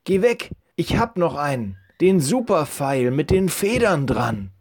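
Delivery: put-on high, eager kid voice; a boy bursting to take his shot. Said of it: "rief Joel".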